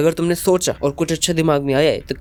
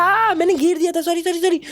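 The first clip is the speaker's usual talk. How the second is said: high flustered shout of apology; loud yell; 12 semitones higher than usual. Retold or imitated. He shouts back at senpai about forgetting wallet, panicked yell. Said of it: "ये जोर से चिल्लाता है".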